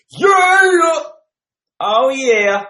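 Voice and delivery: sudden high, loud cheer; real shout of relief; excited shout. Real, not acted. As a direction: yell for joy as the sound comes back. Mic working again, cheering.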